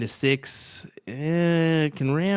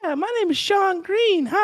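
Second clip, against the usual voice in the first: much higher, squeaky mock imitation of his co-host; mock high-pitched voice; 4 dB louder than usual. Teasing his co-host with a much higher mock voice. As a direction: tease him by squeaking his name way up high. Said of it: "That's not an accent. That's a pitch".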